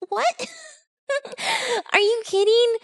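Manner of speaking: high, soft incredulous squeak with a laugh. Retold or imitated. Retells disbelief at support's useless answer.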